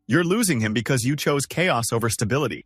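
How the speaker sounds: ordinary delivery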